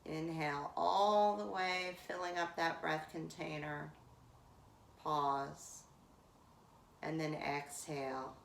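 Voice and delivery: pace the class through each sip of air; guided breath cues. Acted. Yoga teacher cueing the class through Viloma breath, calm guiding voice.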